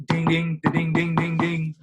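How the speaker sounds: high chiming ding-ding like a desk bell; playful bell-ringing noise; imitated bell ding. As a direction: mimic a service bell being hammered. Buddy rings the hotel bell.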